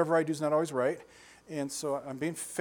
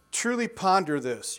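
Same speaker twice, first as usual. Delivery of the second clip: slow emphatic; 3 semitones higher, 3 dB louder, slower than usual. Shown as a demonstration. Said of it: "You ready for this?".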